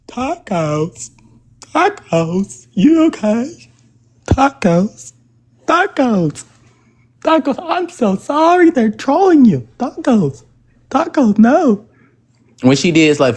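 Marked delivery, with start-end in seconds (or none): 0.1-11.9 high, sing-song mock concern; mocking fake sympathy. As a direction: parody of fake comforting voices, pitched high. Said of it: "and y'all hoes up here".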